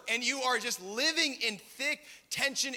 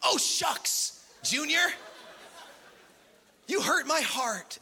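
high, sweet, hammed-up wholesome cartoon voice; mock-wholesome gentle sitcom scolding; Disney movie dad; 6 semitones higher, slower than usual. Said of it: "got angry like a Disney movie".

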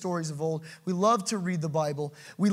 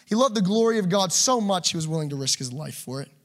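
usual speaking voice

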